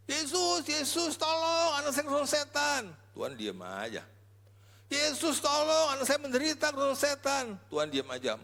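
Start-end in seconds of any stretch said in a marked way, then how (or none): 0.1-2.8 high, loud desperate plea; begging mother. Retold or imitated. Canaanite mother crying out to Jesus for her possessed child, high desperate plea. 4.9-7.5 high, loud desperate plea; begging mother. Retold or imitated. Canaanite mother crying out to Jesus for her possessed child, high desperate plea.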